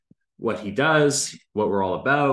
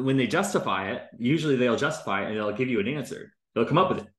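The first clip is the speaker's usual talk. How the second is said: usual speaking voice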